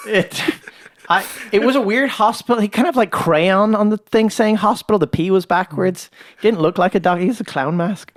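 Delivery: high, playful tall tale; high joking. Real, not acted. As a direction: pitch up for the silly joke. Joking mock story about hospital.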